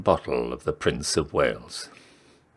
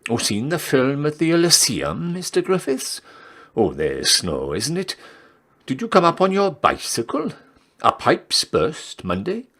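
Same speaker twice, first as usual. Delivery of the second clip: chatty barmaid; 8 semitones higher than usual. Acted. Reader voices gossipy barmaid.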